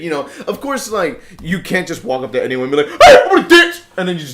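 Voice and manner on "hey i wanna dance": excited. Retold or imitated. Acting out bold approach.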